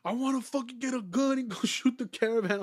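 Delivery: high, loud put-on voice of the store regular; mocking the store regular's proud outburst; mock regular guy. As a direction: imitate the hangaround's boastful rant, hammed-up. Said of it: "And he's like".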